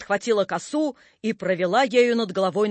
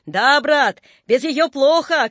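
loud, higher, drawn-out call; shouts from behind; 6 semitones higher, 5 dB louder, slower than usual. A narrator's reading. Spectators at the back agree, hearty shout. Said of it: "кричали сзади".

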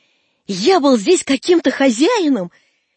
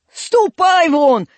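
slow, loud, high dismissal; angry shout; 6 semitones higher, 5 dB louder, much slower than usual. Acted. One-eyed man drives boys off, angry shout. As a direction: shout them away, stretching the words. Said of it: "крикнул кривой человек".

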